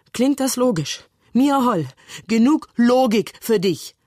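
exasperated, pitched well up; aufgebrachter Streit. Acted. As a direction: throw it at your sister in exasperation. Bruder rechtfertigt sich im Streit. (aufgebracht, mit hoher Stimme)

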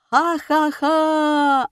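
loud, high, drawn-out mock laugh; crowd laughter. A narrator's reading. Audience answering with derision, laughing loudly. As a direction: roar "ha-ha-ha" like a whole hall. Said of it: "отозвался зал".

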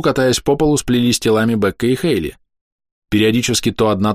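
usual speaking voice

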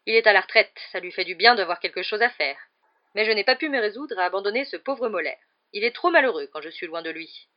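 loud, blustering aunt; hearty, overbearing aunt; big booming voice. A narrator's reading. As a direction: hold forth loudly about the dogs. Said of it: "de sa grosse voix".

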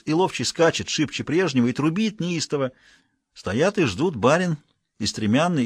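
plain reading voice

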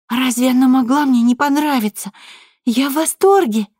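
put-on high, delighted little girl's cry; bursting with delight over a gift; joyful. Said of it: "радостно пробормотала она".

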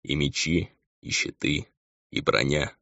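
soft, pensive listing; subdued, resigned reflection; thoughtful. A narrator's reading. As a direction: muse quietly, item by item. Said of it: "сказал он задумчиво".

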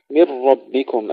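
ربكم with a thick emphatic R; weight the R of ربكم; heavy R. Shown as a demonstration. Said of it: "أيضًا هنا تفخيم الراء". (تفخيم الراء)

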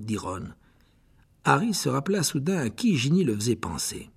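ordinary delivery

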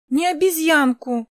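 indignant high-pitched correction; indignant child. A narrator's reading. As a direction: object like an offended little girl. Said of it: "возмутилась Кристина".

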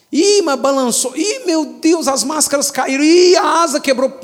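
mock panic. A scared passenger on a shaking plane, mock panic.

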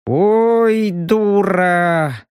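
drawn-out, hopeless groan of a word; resigned drawl. A narrator's reading. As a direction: let it sag in resignation. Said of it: "обреченно протянул Робин".

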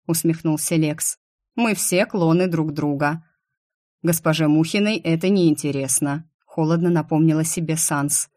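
plain reading voice

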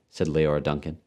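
ordinary delivery, nothing marked

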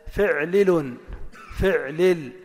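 فعلل loudly as fi'-li-lun, then fi'-lil; pattern demo. Shown as a demonstration. Teacher models the third pattern, loud and measured. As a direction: sound out فعلل with two i vowels round a silent 'ayn. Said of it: "كسرتين بينهما سكون".

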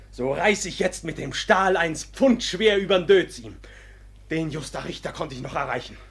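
normal talking voice

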